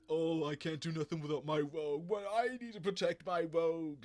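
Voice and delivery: high, loud mock Cajun whine of a needy Gambit; bad Gambit Cajun accent. Vlogger mocking needy Gambit in a Cajun accent. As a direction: ham up a clingy Cajun Gambit, badly. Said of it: "I'm butchering it".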